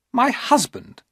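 put-on young woman sneering at the thought; disdainful high female voice; Maria's scornful voice.

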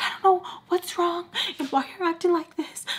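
high, put-on plaintive voice; imitated upset roommate. Roommate asking why she acts like this, plaintive mimicry. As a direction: mimic her high-pitched, wounded pleading. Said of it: "that's how she was talking to me".

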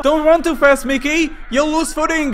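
high, loud panicked yell; shouted warning. Acted. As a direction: yell at Mickey to stop.

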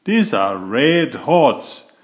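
ordinary delivery

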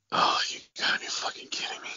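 near-whispered retold disbelief; dismayed aside. Director to himself as kids arrive for R-rated movie, dismayed aside. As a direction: say it under your breath, appalled.

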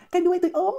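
high mocking repeat of the line; mocking quote. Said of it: "cái đuôi của mày ốm". (giọng cao, nhại chế giễu)

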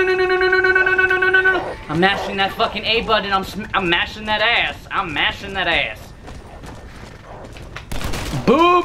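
ordinary talk, nothing marked

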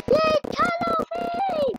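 high excited squeal; squeaky cheer. Pretend.

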